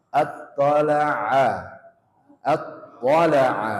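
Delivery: very slow, loud Attala'a; exaggerated ain. Shown as a demonstration. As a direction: drag Attala'a out to stress the ain. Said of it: "jangan attala'a".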